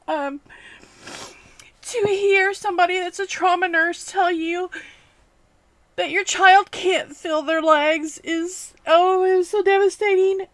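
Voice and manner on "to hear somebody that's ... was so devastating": really upset, high-pitched and close to crying; tearful. Real, not acted. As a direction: push the words out through rising tears. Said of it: "trying to make this video without crying".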